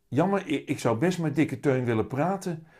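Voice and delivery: put-on little boy voice. A narrator's reading. Willem wishes he could talk with his cat, high child voice.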